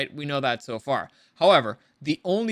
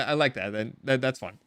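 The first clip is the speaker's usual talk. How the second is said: ordinary talk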